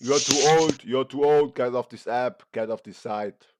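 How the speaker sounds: normal talking voice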